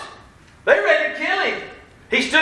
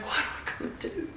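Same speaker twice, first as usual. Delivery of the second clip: whispered, breathless panic; scared whisper of the crowd; frightened whisper; 15 dB quieter than usual. Acted. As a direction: hiss it out in alarm. People panic, voiced in whisper.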